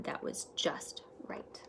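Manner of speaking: hushed whisper of delight; whispered just right. A narrator's reading. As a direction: breathe it like a secret. Found the right book.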